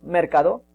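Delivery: Mercado with the stress moved off CA, ending on a rise; deliberately misstress Mercado, questioning; misplaced stress. Shown as a demonstration. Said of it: "¿y no podía ser en la primera?".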